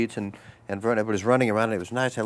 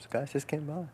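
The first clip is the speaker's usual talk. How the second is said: ordinary talk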